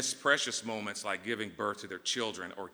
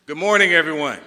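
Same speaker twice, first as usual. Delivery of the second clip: big booming good morning, much louder; louder greeting; 7 semitones higher, 17 dB louder than usual. Real, not acted. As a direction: call it out to the whole crowd. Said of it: "let's try that one more time".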